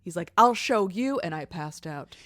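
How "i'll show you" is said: loud, raised-pitch retort; mock defiance. Co-host quoting the father before he passes out, mock-defiant. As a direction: punch it out as a hammed-up threat.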